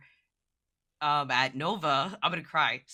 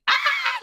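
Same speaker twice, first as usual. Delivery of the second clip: excited shriek; 6 dB louder than usual. Retold or imitated. Reliving screaming on the floor.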